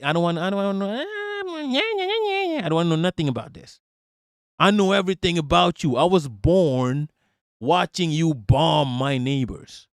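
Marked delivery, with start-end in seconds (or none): 0.0-3.8 whiny high voice. Retold or imitated. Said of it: "I'm like".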